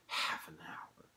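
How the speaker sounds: slow, whispered murmur. A narrator's reading. Dorian lighting a cigarette, murmuring wearily under his breath. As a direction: breathe it out very softly, drawn out. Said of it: "he murmured".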